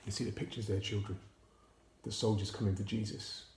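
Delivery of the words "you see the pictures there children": plain reading voice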